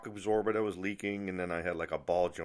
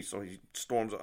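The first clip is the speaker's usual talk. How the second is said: ordinary talk, nothing marked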